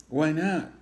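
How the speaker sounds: put-on high, loud little kid whine; child protest. Child lizard protesting to his mom, high and pleading. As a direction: push back like a small boy.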